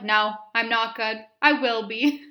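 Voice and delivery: a mock-brave sob through tears; retold sobbing. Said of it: "And I'm like".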